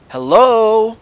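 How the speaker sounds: high, loud mocking "hello?"; sarcastic disbelief. Real, not acted. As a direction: call out as if nobody's home. Sarcastic call-out at obvious oversight.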